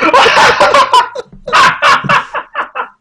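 loud, high, helpless laughter; real laughing fit. Real, not acted. Podcaster reacting to the poster twist, real loud laughing fit. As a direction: crack up completely.

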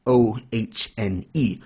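ordinary delivery

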